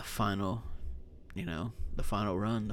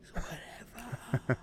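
mocking whisper echo; 4 semitones higher, 6 dB quieter, slower than usual. Echoing partner's shrug mockingly.

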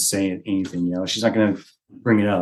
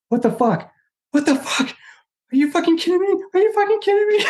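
shrieking higher and higher in shock; high, excited mock disbelief climbing to a squeal; stunned disbelief. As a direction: squeal it out, more stunned each time. Wife stunned by the proposal.

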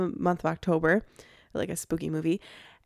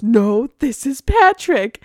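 slow, loud, dopey Patrick line; a light cartoon Patrick take; lightly voiced SpongeBob's Patrick; 4 semitones higher, 8 dB louder, slower than usual. Quoting Patrick from SpongeBob episode.

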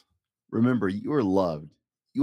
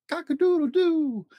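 very high, loud crowing cock-a-doodle-doo; rooster crow; 4 dB louder than usual. Acted. Dad reading Seuss to a child, loud rooster crow. Sound list.

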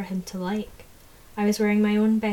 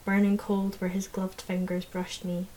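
plain reading voice

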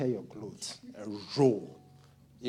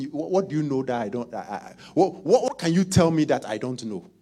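mocking rich snob; 5 semitones higher, 8 dB louder than usual. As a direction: sneer down at a poor man and shout it. Preacher acts rich man mocking poor.

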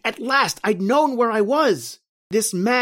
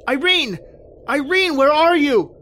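desperate shouting; 9 semitones higher than usual. Acted. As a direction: shout her name into the forest, then fade. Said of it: "crying, shouting".

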